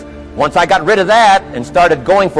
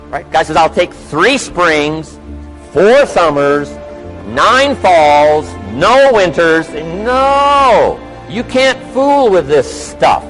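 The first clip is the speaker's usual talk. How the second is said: booming motivational speech; 3 semitones higher than usual. Acted. Motivational speaker addressing an audience.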